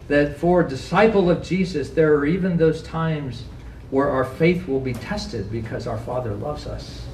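normal talking voice

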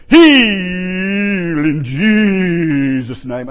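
very slow, loud, hammed-up faith healer; TV evangelist parody. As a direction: boom it out like a showy televangelist.

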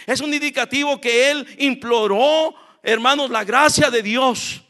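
high, forceful pulpit delivery; impassioned preaching. Real, not acted. Preacher telling congregation Jacob surrendered to God, impassioned preaching.

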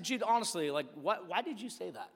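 low, soft, earnest question; retold quiet exasperation; subdued frustration. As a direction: drop your voice and plead for an honest answer. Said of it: "I was legitimately frustrated".

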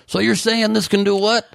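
playing dumb, high voice; mock innocent question; acting dumb. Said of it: "He's like".

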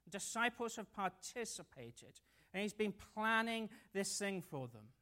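usual speaking voice

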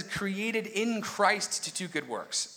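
slow, weighted reading of "Created in Christ to do good works."; emphatic reading. Shown as a demonstration. Pastor to congregation, slow emphatic reading. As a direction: lean on each word of "Created in Christ to do good works." slowly. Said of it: "notice how it says".

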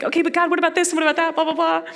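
quick mock nagging; rattled-off questions. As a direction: rush through the list.

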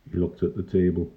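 usual speaking voice

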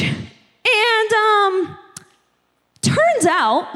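normal talking voice

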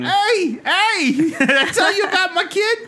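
high-pitched put-on voice, breaking into laughter; Mike Dayton. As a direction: pipe up way high, drawn-out "hey"s, half laughing. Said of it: "You'll have Mike Dayton in the third seat".

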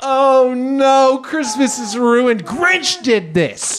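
high, slow hammed-up lament; mock despair. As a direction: wail over ruined Christmas. Mock outrage about ruined Christmas.

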